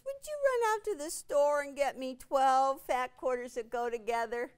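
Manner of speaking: lightly whiny pleading. Retold or imitated. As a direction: beg like a whiny child asking a favor. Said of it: "I had to use my whiny voice".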